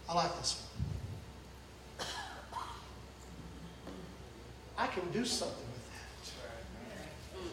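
hushed, slow, breathy voice of God; tender whisper. Acted. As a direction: lean in and whisper it gently. God voiced tenderly over broken life.

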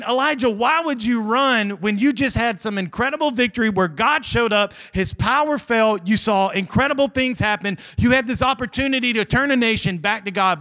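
high, loud, urgent questioning; impassioned preacher's rebuke; incredulous preaching. Acted. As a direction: challenge him in disbelief, piling up the reasons. Preacher challenges Elijah at force.

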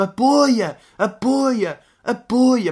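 high, loud repeated "Apoia"; retold rugby yell. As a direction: bark the call across the pitch. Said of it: "um gajo a gritar".

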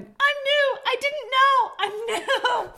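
high, loud, giggling shout; a panicked nurse squealing through laughter; laughing shout. A narrator's reading. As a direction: yell it over your shoulder while laughing. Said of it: "she ran out the door shouting".

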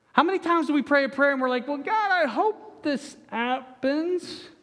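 mock a timid half-hearted prayer; doubtful prayer.

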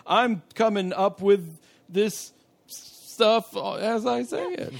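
high, slow, halting; mock improviser. Mocking the writers ad-libbing, slow and high. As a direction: parody someone making it up. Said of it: "some of this is just like".

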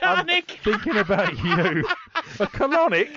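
high, loud, cheeky; high teasing voice. Acted. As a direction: play the smug gift giver. Mock partner in gift skit.

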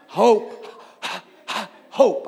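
"Hope" with a hard, loud puff of H; emphasized H. Shown as a demonstration. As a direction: blast the H at the start of "Hope". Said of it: "Emphasize the H".

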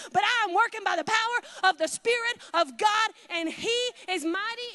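preaching at full force. Real, not acted. Preacher building to a peak before the church. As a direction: preach it out with fire. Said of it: "I just preached my earring off".

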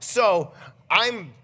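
loud, drawn-out comic exasperation; mock anger. Dad retelling his anger at the mess, exasperated. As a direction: stretch it out in re-enacted fury. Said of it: "I'm so angry".

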